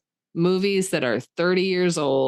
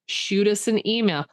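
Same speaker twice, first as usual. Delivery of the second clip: ordinary talk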